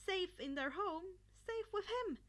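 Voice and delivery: ordinary delivery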